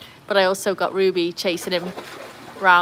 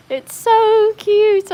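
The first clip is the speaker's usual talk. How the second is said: high, loud, drawn-out delight; overwhelmed by cuteness, sky-high pitch; gushing squeal; 13 semitones higher, 8 dB louder, much slower than usual. Real, not acted. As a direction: squeal it slowly with glee. Gushing over own t-shirt designs.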